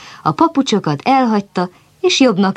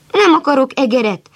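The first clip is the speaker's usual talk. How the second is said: indignant donkey; 9 semitones higher than usual. A narrator's reading. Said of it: "kapta fel a fejét a buta csacsi".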